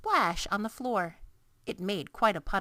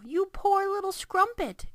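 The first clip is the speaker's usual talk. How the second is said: higher, pitying cry; 13 semitones higher, 5 dB louder than usual. A narrator's reading. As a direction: cry out in sympathy. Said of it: "cried the B F G".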